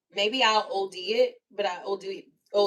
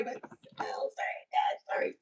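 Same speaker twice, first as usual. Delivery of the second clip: trailing off into a whisper; sorry murmured under the breath; 7 dB quieter than usual. Retold or imitated. Worker apologizing to reviewer, fading to a whisper.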